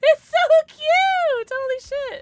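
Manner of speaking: very high, loud, stretched-out squeal; thrilled squeal. Real, not acted. Streamer seeing their new outfit, thrilled squealing. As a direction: squeal with delight at full volume.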